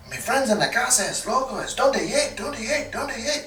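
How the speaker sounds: high, mock-Spanish drunk babble; high drunken pidgin Spanish; drunk broken Spanish. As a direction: fumble through bad Spanish, drunk.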